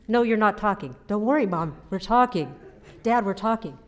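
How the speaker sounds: emphatic retold bickering; retold squabble. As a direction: act out a family squabble, firmly and loudly. Acted back-and-forth between parents and sisters.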